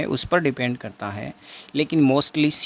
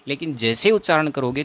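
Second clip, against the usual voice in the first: ordinary talk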